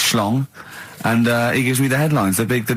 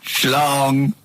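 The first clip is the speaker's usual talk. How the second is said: the word Schlong intoned like a news chime; mock news bong. Host marking each headline with a spoken bong. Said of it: "I do a bong".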